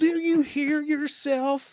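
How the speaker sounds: high-pitched, loud, slow exasperated yell; screaming at phone. Retold or imitated. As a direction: shriek each word at the offender, drawn out. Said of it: "was screaming at the, my phone".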